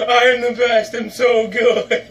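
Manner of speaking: loud mocking boast, drawn out at the end; exaggerated gloating impression; mock self-gloating. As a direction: imitate a friend bragging about himself. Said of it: "Ryan's just self-gloating over there".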